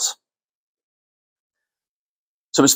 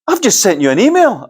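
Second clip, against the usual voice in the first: Caroline on the phone; 9 semitones higher, 3 dB louder than usual. Retold or imitated. Caller Caroline voiced in story.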